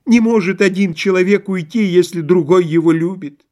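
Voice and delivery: high, insistent lament; exclaimed protest. A narrator's reading. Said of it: "воскликнул Лоханкин".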